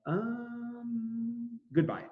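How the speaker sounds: curt dismissal. Retold or imitated. Washington dismissing Citizen Genet, deadpan goodbye. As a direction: brush him off with a deadpan goodbye.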